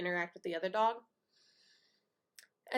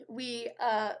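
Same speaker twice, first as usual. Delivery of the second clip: usual speaking voice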